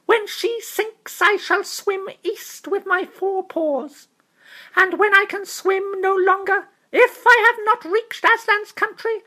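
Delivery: high, small, proud mouse voice; a tiny gallant knight, firm and noble; brave mouse knight. Acted. Reepicheep answering Lucy in a high, proud mouse voice.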